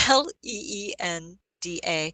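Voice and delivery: usual speaking voice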